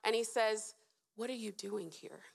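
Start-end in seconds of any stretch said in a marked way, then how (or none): 1.2-2.2 put-on hushed and tender, like consoling a hurting child; a quiet, consoling parent's question; gentle whispered question. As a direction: softly ask "What are you doing here?" as a parent would. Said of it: "that whisper".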